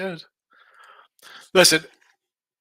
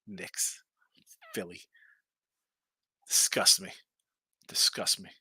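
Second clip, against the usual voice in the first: disgusted whisper; 7 semitones lower, 6 dB quieter, slower than usual. Acted. Disgust at Philly.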